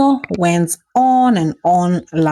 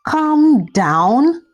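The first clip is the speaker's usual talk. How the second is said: "Calm down?" higher and sharper, anger building; rising anger; 7 semitones higher than usual. Acted. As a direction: push "Calm down?" up, more outraged. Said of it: "Shade said angrily".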